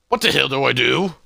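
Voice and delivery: fast, loud burst of alarm; panicked outburst. Acted. Character panics over dangerous find.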